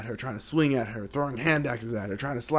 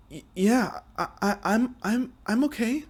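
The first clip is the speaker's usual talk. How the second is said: higher, stammering; shaken and out of breath; 9 semitones higher than usual. Acted. Marcia answering Ike after long fight, breathless stammer. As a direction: stutter through exhaustion. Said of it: "definitely out of breath".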